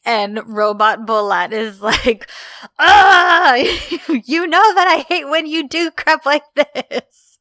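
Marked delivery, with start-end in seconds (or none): 2.8-7.4 loud, high wail of frustration; playful over-the-top whine, loud and high; mock exasperated outburst. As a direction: cry out in hammed-up exasperation, laughing. Acted exasperated outburst of retold character.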